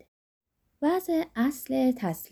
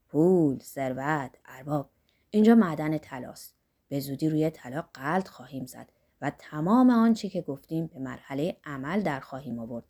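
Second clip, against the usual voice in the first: put-on lightly lowered voice of an eager old man; Zorba's voice; 8 semitones lower than usual. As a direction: rub your hands over the gold, voice dropped low. Zorba rubs hands, voiced lower.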